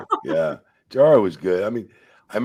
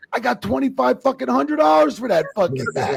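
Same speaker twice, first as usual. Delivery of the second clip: high, louder retold outburst; indignant complaint; 5 dB louder than usual. Referee complaining to wrestlers about his match pay, indignant. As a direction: act out an outraged protest over pay.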